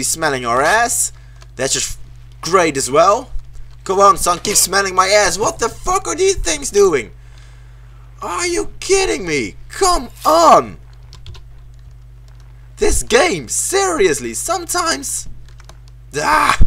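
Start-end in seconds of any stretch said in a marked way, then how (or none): 5.4-16.6 high-pitched exasperation; voice getting high. Real, not acted. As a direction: let frustration push the voice up high. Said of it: "my voice is getting higher and higher".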